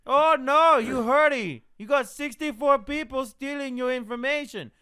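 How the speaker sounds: high, loud mocking scam voice; 1 mock scammer whine. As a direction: ham up the fake alarmed scammer. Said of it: "mocking scammer".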